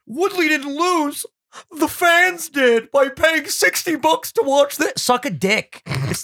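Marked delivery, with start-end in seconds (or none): 0.1-4.9 higher, slow smug mockery; sneering commenter voice, drawn out; smug internet troll. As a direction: read it like a know-it-all troll. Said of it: "always the best comment".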